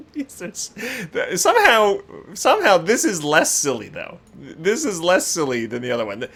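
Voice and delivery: ordinary delivery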